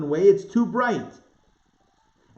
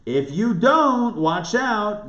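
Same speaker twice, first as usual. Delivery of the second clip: slow, higher-pitched mock warning; drawn-out threat, lightly acted; stern warning; 6 semitones higher, much slower than usual. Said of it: "as a negative".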